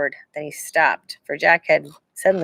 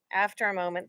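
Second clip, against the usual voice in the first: ordinary delivery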